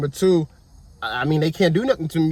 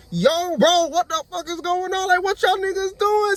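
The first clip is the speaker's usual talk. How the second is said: high, heated retold yell; angry outburst; 11 semitones higher than usual. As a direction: fire it back at the cops, outraged. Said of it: "So I'm, like, mad at this point".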